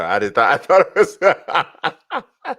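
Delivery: high, loud laughter breaking up the words; laughing. Real, not acted. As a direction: crack up mid-sentence, unable to finish. Cracking up at own joke.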